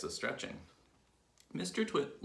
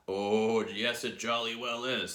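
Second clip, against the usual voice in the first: loud, higher, emphatic contradiction; gloating insistence; 3 semitones higher, 7 dB louder, slower than usual. A narrator's reading. Husband contradicting his wife, loud and emphatic. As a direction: insist with relish, hitting it hard.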